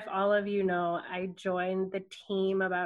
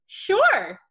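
high, loud eager sure; bright student jumping at the request; eager yes; 11 semitones higher, 9 dB louder than usual. Retold or imitated. Students agreeing to an extra stop, eager high yes.

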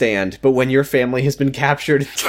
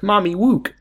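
put-on high baby talk, W for L; little-girl voice; 8 semitones higher than usual. Daughter calling her mother to look, high babyish voice. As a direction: lisp it like a small child wanting attention.

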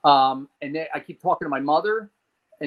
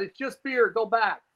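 retold yell; 8 semitones higher than usual. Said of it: "So he yells out".

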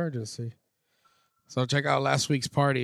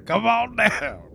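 laughing, lighter copy of the pitch; laughing ad echo; 7 semitones higher than usual. Retold or imitated. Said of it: "know Bob's Furniture".

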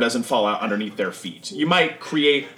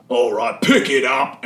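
barked order up the stairs; loud call; 5 dB louder, slower than usual. Acted. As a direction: shout it from below. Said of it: "you hear a very loud".

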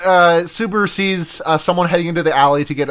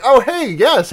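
higher, mock-friendly greeting; feigned recognition; 6 semitones higher, slower than usual. Podcaster imitating a would-be rescuer greeting her, faked familiarity. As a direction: pretend you know this stranger. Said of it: "he does, like, the classic ally thing".

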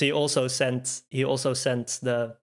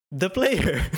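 higher, overdone dramatic line about to crack up; barely holding laughter; 6 semitones higher, 4 dB louder than usual. Acted. Reader voicing Ephemer's shocked line, barely holding laughter. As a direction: play it big while fighting giggles. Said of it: "can't say this without laughing".

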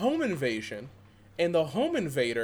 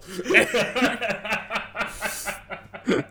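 breathless laugh after shouting; real laugh that trails off; 5 semitones higher than usual. Real, not acted. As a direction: let the hype collapse into laughter. Laughing after own hype.

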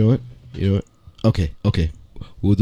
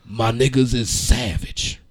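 lower, slow and solemn, like a grand recital; dramatic reading; 7 semitones lower, 6 dB quieter than usual. Shown as a demonstration. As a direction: read rap lyrics like a poem on stage. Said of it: "we are gonna have a dramatic reading".

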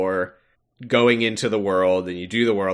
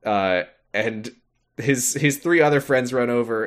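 ordinary delivery

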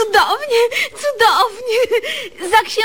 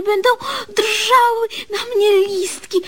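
usual speaking voice

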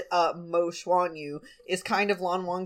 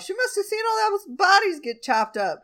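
very high, incredulous questioning; mock bafflement; 13 semitones higher, 3 dB louder than usual. Podcaster voicing a character puzzled by stitched corpses, mock baffled. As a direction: pitch it way up in exaggerated disbelief.

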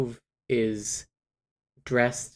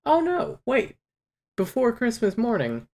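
much higher, oh no wait; sudden realization; 12 semitones higher, 3 dB louder than usual. Real, not acted. Telling her friend about the book, suddenly remembering. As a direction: catch yourself mid-story.

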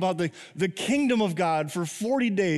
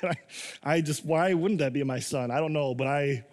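usual speaking voice

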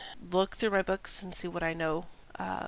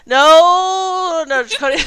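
loud, high, drawn-out "no"; alarmed refusal; 10 semitones higher, 18 dB louder, slower than usual. Acted. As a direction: shout down a terrible idea. Drowning out offer to fight dad.

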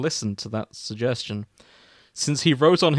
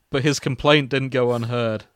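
usual speaking voice

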